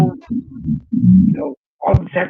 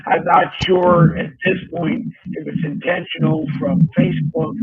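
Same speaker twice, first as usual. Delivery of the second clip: usual speaking voice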